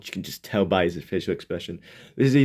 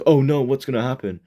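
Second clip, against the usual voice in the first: mock peril; 6 semitones higher, 5 dB louder than usual. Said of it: "I love it's more peril".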